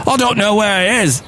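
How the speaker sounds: put-on pushing harder at a northern English accent that doesn't land; failed northern accent. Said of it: "I can't do a northern accent".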